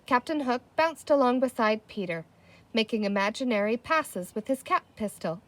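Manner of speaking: ordinary delivery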